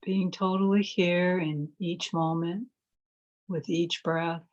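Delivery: calm, measured phrases with long silences between; guided meditation. Real, not acted. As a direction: guide listeners gently into stillness, leaving space to breathe.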